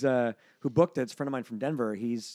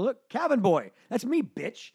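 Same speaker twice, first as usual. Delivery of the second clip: higher-pitched triumphant comeback; mock-indignant boast, pitched up; cocky comeback; 8 semitones higher, slower than usual. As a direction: show off your proof to the doorman. Acts out arguing with club doorman.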